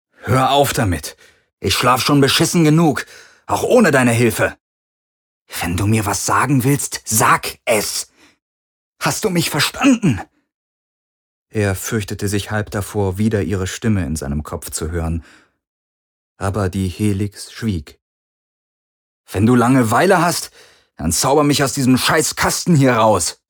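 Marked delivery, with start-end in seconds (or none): 0.1-10.2 angry yelling. A narrator's reading. Garland yells at the Helix. 19.3-23.4 angry outburst. A narrator's reading. Garland snaps at the Helix.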